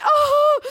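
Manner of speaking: high, loud startled yelp; a sudden shriek of alarm; panicked yelp. Retold or imitated. Cows charging, panicked cry.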